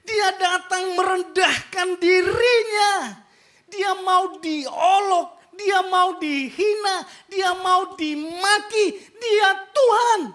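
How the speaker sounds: preacher crying out to the congregation; tearful preaching. Real, not acted. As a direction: voice breaking with emotion. Preacher overcome while preaching.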